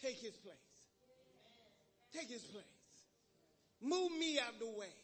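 soft, hushed prayer over music. Acted. Preacher praying softly over the congregation. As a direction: pray quietly, drawing out the Lord's name.

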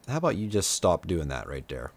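quiet, low, fed-up request; low sarcastic suggestion to a dragon; low annoyed grumble. Real, not acted. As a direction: grumble it under your breath at the game.

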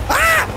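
sudden high yelp of shock; a sharp scream as he almost falls; startled scream. Real, not acted. Gamer yelping at a near fall, sharp high scream.

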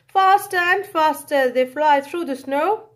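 ordinary delivery

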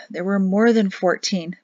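ordinary delivery, nothing marked

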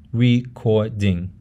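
recording slowly split into re-cor-ding; syllable by syllable. Shown as a demonstration. Teacher breaks up an English example, syllable by syllable. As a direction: say recording in three separate syllables. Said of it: "how you break up a word".

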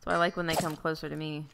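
ordinary delivery

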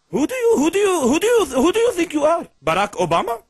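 high, loud mocking rant; mock angry retort. Caller mimicking the American's rant about coffee, high and mocking. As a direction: throw it back at him in a hammed-up indignant voice.

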